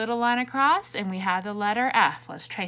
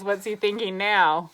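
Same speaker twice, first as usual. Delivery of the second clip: ordinary delivery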